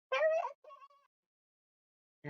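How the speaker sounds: put-on very high, drawn-out old granny voice; Grandma. Grandma answers a knock, high and slow. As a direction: call out slowly in a high falsetto.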